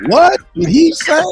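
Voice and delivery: high, loud stunned question; a big high yelp of surprise; shocked disbelief. Retold or imitated. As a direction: recoil in disbelief at what you heard. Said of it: "I was like".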